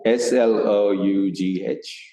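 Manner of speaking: slow letter-by-letter spelling; spelling slowly. Real, not acted. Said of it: "So tell us the spelling".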